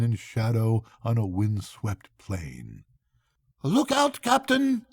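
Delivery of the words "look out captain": much higher warning shout; crying out. A narrator's reading. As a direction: call the captain's attention sharply. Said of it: "he cried".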